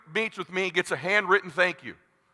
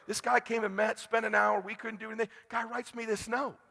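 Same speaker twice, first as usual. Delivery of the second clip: quiet retold quote; 2 semitones higher, 9 dB quieter than usual. Voicing a referring client.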